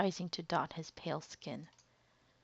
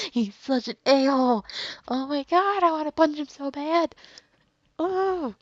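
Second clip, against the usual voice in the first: much higher and louder, furious; real outrage; 9 semitones higher, 8 dB louder than usual. Real, not acted. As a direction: blurt out genuine fury at the villain. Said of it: "I'm so mad right now".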